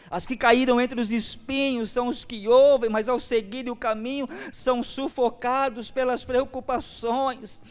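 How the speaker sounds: high, loud pulpit preaching; fervent preacher pressing the point; full-force preaching. Acted. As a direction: preach it out to the congregation.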